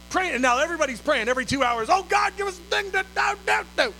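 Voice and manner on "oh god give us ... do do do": high mock pleading; frantic prayer. Imitating everybody praying every two hours, frantic pleading. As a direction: plead high, dissolving into nonsense syllables.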